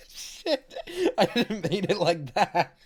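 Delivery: high, loud laugh through the words; can barely get it out for laughing. Real, not acted. Streamer protesting to chat while laughing hard. Laughing after accidental innuendo.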